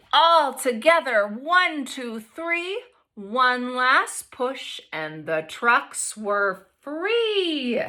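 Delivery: expressive picture-book read-aloud; reading to children. A narrator's reading. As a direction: read it to the class with lively voices.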